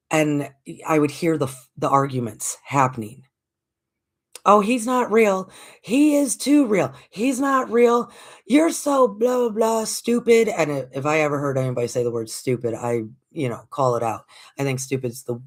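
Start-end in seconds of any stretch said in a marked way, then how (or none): 4.5-6.9 put-on high, loud childish squabble; bickering kids. Kids on the bus fighting about Santa, high and loud. 7.1-8.1 high, loud "He's not real"; bickering kid. Retold or imitated. Kid on the bus insisting to another, high and loud. 8.5-10.5 put-on high, loud childish squabble; bickering kids. Kids on the bus fighting about Santa, high and loud.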